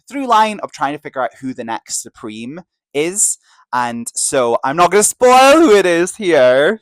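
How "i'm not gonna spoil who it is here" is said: loud, high, stretched-out announcement; pointedly loud, teasing disclaimer; emphatic no-spoiler warning. Real, not acted. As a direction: sing out the warning, drawing the words out. Teasing warning aimed at listener Luke.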